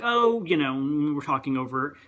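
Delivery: ordinary delivery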